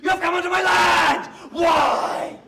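retelling a farmer's furious shout; angry old man shouting. Retold or imitated. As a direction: bark it like a territorial farmer. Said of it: "he shouts".

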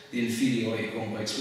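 ordinary delivery

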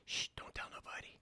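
playful hush, fully whispered; conspiratorial whisper. Pretend. Tutor hushing viewers about a slip, mock-secret whisper.

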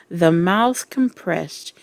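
ordinary delivery

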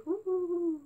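high hooting ghost noise; mock spooky ghost wail; spooky ghost woo. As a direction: hoot like a playful ghost. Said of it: "I'm scary".

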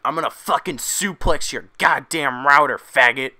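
loud screaming threat in a strained put-on voice; unhinged, yelling down the headset; hysterical gamer rage. Crying hacker threatening a kid online, hysterical shout. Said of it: "And Bobby's like".

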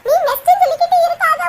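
ordinary talk, nothing marked

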